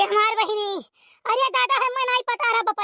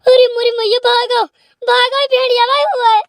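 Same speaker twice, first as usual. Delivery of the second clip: panicked cry; 4 semitones higher, 9 dB louder than usual. Acted. Spotting the wolf, panics.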